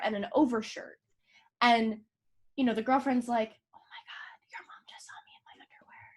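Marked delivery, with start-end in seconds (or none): 3.7-6.1 hushed, fast unvoiced whisper; panicked whisper from the next room. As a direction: whisper it so the mom won't hear. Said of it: "you can hear her whispering".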